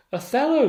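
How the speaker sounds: the title Othello called out high and loud; dramatic announcement. Real, not acted. Teacher to students, turning to the next play: dramatic loud call. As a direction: throw out Othello as a big reveal.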